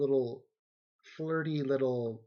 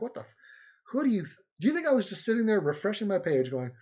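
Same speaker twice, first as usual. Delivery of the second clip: indignant, higher-pitched retort; mock outrage; 7 semitones higher, 4 dB louder than usual. Busy streamer griping about a Photoshop favour, mock outrage. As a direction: act put-upon and incredulous.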